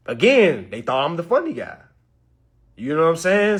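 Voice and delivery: ordinary delivery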